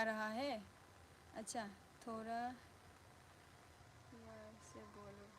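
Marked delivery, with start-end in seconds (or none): 4.1-5.3 very soft, barely voiced; hushed and gentle; low-energy delivery. Shown as a demonstration. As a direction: say it quietly, holding the energy back. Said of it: "energy से बोलो".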